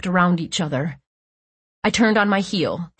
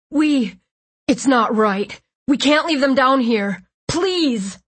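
pleading; 6 semitones higher, slower than usual. Acted. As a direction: beg them not to leave. Boy begs to save bodies.

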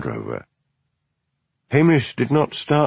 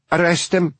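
higher, urgent cry to the constable; shouted demand; 8 semitones higher than usual. Acted. Spinster bursting in on the policeman, a high urgent shout. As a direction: demand it shrilly at once. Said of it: "Nessie shouted".

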